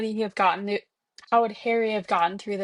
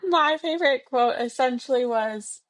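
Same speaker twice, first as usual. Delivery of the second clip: ordinary delivery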